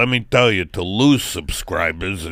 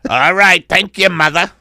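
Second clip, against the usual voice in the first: raised, loud voice of a dutiful son; older-sounding son; 9 semitones higher, 8 dB louder than usual. Acted. Son thanking his English mother, loud put-on voice. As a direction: answer mother in a put-on, higher voice. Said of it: "Oh, you sound older".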